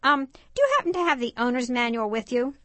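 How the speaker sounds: put-on high-pitched boy Stanley; eager car-obsessed boy. Stanley tells his dad's friend about brakes, high boyish voice.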